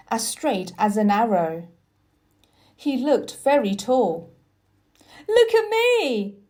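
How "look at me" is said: much higher, loud cry of triumph; boy shouting that he stands; triumphant shout. Acted. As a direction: call out proudly, standing tall. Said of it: "he shouted".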